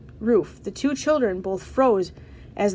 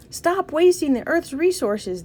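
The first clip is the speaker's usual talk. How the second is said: high, raised protest cry; yelled slogan; 7 semitones higher than usual. A narrator's reading. Campaigners marching to the town square, moderate yell. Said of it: "they yelled".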